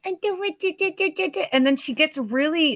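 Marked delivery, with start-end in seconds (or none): 0.1-1.4 high, cutesy singsong babble; much higher, playful little patter; Carol Kane. Retold or imitated. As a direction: mimic a cute, playful fairy, pitched way up. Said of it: "the way that she talks, she's like".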